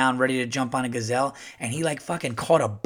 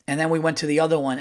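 ordinary talk, nothing marked

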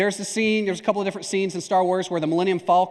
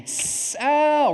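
mock burn yelp; 8 semitones higher than usual. Preacher acts out kid touching hot stove, mock pain. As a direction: hiss of the stove, then a higher pretend yelp.

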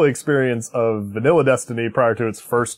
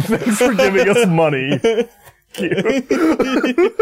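laughing mockery; 11 semitones higher, 3 dB louder, much slower than usual. Mocking thankful company.

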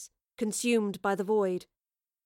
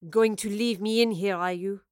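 contemptuous. Acted. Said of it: "Lorelei said contemptuously".

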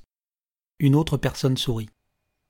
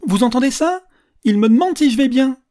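high, loud public appeal; loud, theatrical old man to a crowd; addressing the whole carriage; 9 semitones higher, 7 dB louder than usual. A narrator's reading. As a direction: call the whole train to witness. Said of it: "il prit le wagon, c'est-à-dire le train entier à partie".

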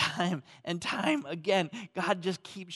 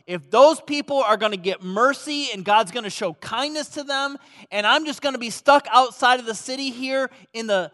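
loud, higher angry complaint as Jonah; voicing bitter Jonah; 5 semitones higher, 9 dB louder than usual. Pastor voicing Jonah's bitter complaint, loud and high. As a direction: rant like a man who'd rather die.

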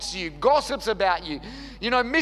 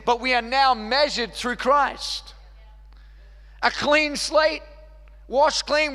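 raised, louder preaching voice; full-force preaching; 5 semitones higher, 4 dB louder than usual. Acted. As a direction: preach it out with rising fire.

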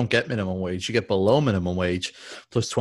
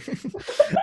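airy unvoiced giggle; short breathy burst of laughter. Real, not acted. Breaks into laughter.

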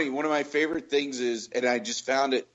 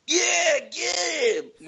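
cheering dad; much slower than usual. Retold or imitated. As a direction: yell it like a fan egging on the hero.

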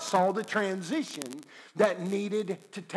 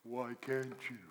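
quiet God voice; 8 semitones lower, 14 dB quieter than usual. Acted. God questions the believer.

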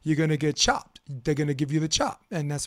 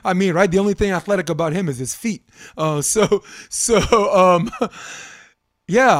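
laughing; 3 semitones higher, 6 dB louder than usual. Real, not acted. Laughing at his own joke.

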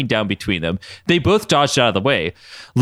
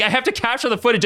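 high imitating voice; 12 semitones higher, faster than usual. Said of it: "I'd be like".

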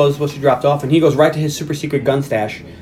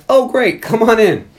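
higher, bright mock welcome from the Russians; mock friendly Russians; 8 semitones higher, 3 dB louder, slower than usual. Russians welcoming Ghost at the door, mock cheerful. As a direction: pitch it up like a fake-cheerful greeting.

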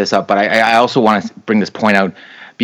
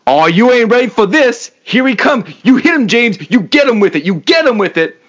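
hype man; 13 semitones higher than usual. Pretend. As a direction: pump up James like a hype man. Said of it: "here's my audition tape for".

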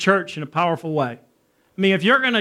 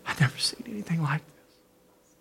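hushed, breath-only amazement; soft, stunned whisper; awed whisper; 10 dB quieter than usual. Retold or imitated. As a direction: whisper it to yourself in awe. Quiet awe after the prayer time.

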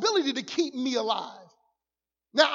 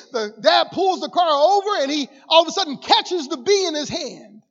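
usual speaking voice

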